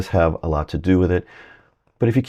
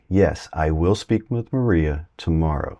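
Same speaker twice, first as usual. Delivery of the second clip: ordinary talk, nothing marked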